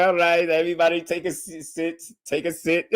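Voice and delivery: lightly voiced host lady calling for order, with a laugh; mock the lady asking everyone to sit down, half laughing; imitating the host. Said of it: "she was like".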